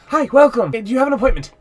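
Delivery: high, loud eager doorman; chirpy gatekeeper at the door. Acted. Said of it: "he's like".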